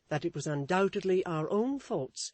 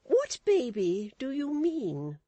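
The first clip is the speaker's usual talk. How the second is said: much higher, prim lady's voice; Missus Chick's voice; 10 semitones higher, 6 dB louder, slower than usual. A narrator's reading. Wife lecturing her husband, in a high prim voice. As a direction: preach a lesson, high and proper.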